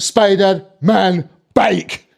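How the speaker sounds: very slow Scottish deadpan; Scottish kid. Acted. Scottish kid repeating demand, slow Scottish deadpan. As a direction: drag out each word in a Scottish accent. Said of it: "This Scottish kid".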